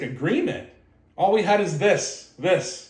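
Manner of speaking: a hint of an old friend laughing off the question; mild amused disbelief, pitch up; amused retelling. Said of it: "he was laughing when he told me".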